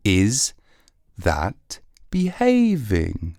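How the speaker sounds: drawn-out reproachful question; slowly, like a disappointed parent; slow stern question. Pretend. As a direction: spell it out to a misbehaving mate. Slow repeated scolding.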